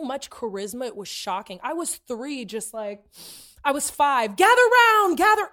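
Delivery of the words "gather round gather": high, loud showy call. Retold or imitated. Five-year-old calling everyone to her show, calling out. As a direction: summon an audience like a little performer. Re-enacts herself as a child performer.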